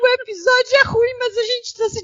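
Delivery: stifled giggles. Real, not acted. Laughing about the bad episode.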